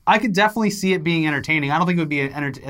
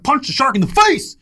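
high, loud comedian bit; Dane Cook impression; 10 semitones higher, 4 dB louder than usual. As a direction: shout it like a stand-up punchline. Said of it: "a Dane Cook joke".

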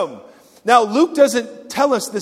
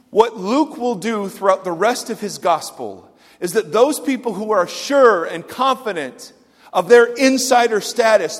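usual speaking voice

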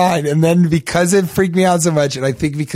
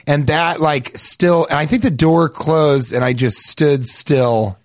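usual speaking voice

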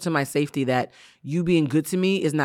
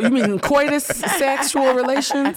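mock prim; 7 semitones higher, much slower than usual. Teasing euphemism for sex.

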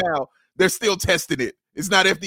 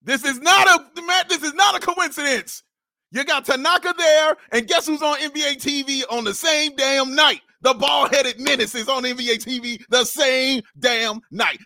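excited rant; 7 semitones higher than usual. Real, not acted. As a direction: rant like it's a conspiracy. Ranting about a conspiracy.

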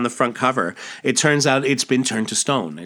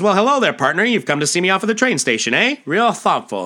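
hearty, much higher and louder salesman voice; folksy salesman; 9 semitones higher, 4 dB louder than usual. Acted. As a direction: boom it out like a glad-handing huckster. Salesman McKay greets Superboy.

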